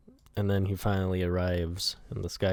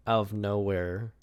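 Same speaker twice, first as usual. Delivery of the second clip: ordinary talk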